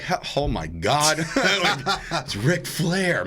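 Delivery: slow, stretched-out awe breaking into a laugh; high, drawn-out mock amazement; starstruck. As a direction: gush like a fan meeting an idol. Acted awe at meeting an idol.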